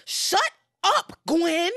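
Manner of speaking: high, loud mock outburst; fed-up Whitney. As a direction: punch out each word, exasperated. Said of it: "Whitney turns to her and says".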